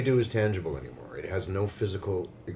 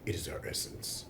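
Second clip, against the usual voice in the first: dramatic whisper; 5 semitones lower, 12 dB quieter than usual. Pretend.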